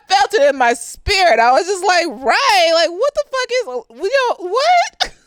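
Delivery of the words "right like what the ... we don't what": rising higher into a squeaky final what; baffled disbelief. Retold or imitated. Reacting to the flat earth talk, high baffled disbelief. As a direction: act stunned, pitch climbing.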